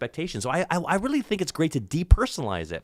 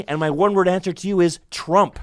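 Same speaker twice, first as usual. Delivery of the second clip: usual speaking voice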